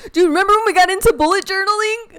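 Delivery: high, loud burst of real excitement; excited outburst. Real, not acted. Friend recalls a shared bullet journaling craze, excited. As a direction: blurt it out, loud and high, laughing.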